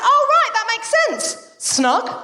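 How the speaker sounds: high, chirpy surprised doctor voice; doctor popping up. Retold or imitated. Doctor rising from below, chirpily surprised. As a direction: pop up brightly like the doctor. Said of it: "she popped up and went".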